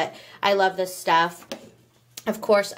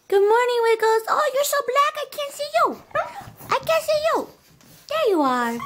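put-on much higher, cooing baby talk to a dog; high pet talk. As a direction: coo at the little dog like at a baby.

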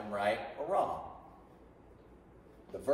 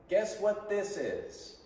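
slow, higher-pitched teacher prompt; repeat-after-me model; 7 semitones higher, slower than usual. Shown as a demonstration. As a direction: model it slowly for the class to echo. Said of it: "speak after me".

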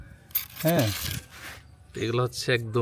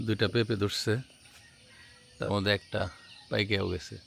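soft vlog narration; 4 semitones lower, 10 dB quieter than usual. Acted. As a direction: murmur the morning scene gently. Soft morning voice-over narration. (নরম শান্ত voice-over)

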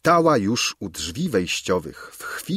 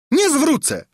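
much higher, louder squawk of refusal; screeching refusal; 4 dB louder than usual. A narrator's reading. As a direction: screech it, stamping in fury. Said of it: "zaskrzeczała Gburia Furia". (skrzeczące, wściekłe „Nie zwrócę!”)

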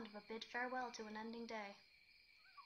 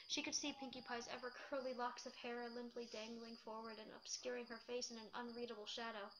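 ordinary delivery, nothing marked

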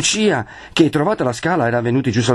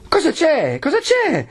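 high, insistent repeated question; urgent questioning; slower than usual. A narrator's reading. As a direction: pitch it way up, pressing for an answer. Agnese urgently holding Perpetua back. (domanda insistente, acutissima)

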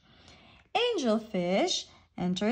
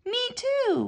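wailing; 11 semitones higher than usual. A narrator's reading. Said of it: "wails Pufferfish".

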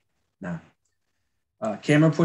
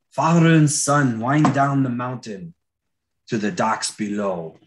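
slow, louder dramatic trailer read of the Scottish scene; pitching a storyboard; 5 dB louder than usual. Shown as a demonstration. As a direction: sell the shot like a movie trailer. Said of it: "you gotta pitch it".

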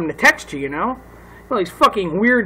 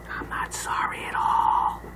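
slow, drawn-out whisper; mock-secret whisper; 11 dB quieter, slower than usual. Comedian takes back his apology to the neighbors, whispering. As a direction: whisper it slowly as a cheeky aside.

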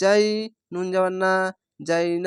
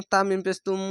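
ordinary talk

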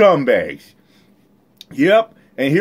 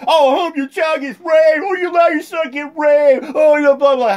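mocking imitation; 11 semitones higher than usual. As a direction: throw their taunts back in a shrill mock shout. Mocking the clip's insults.

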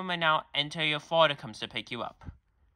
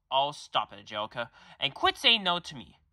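ordinary delivery

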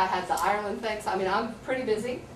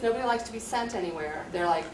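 ordinary talk, nothing marked

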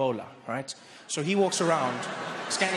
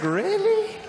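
high, loud, incredulous; stunned by the betrayal; betrayed disbelief; 11 semitones higher, 5 dB louder, much slower than usual. Retold or imitated. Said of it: "I was like".

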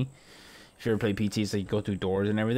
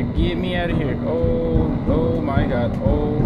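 loud, much higher desperate pleading; panicked screaming; 8 semitones higher, 8 dB louder than usual. Real, not acted. Player stuck in a vent, screaming to get out. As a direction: beg to get out, terrified. Trapped while fleeing.

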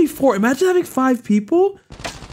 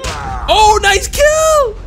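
excited cheer; 13 semitones higher, much slower than usual. Real, not acted. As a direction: cheer a great play. Cheering a teammate's kill.

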